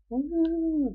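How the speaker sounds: high, hollow spooky ghost woo. Pretend. Reading her note aloud, a playful ghost woo. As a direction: hoot it out like a cartoon ghost. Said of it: "I wrote ghost voice".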